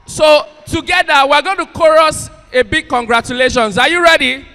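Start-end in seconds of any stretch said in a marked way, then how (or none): none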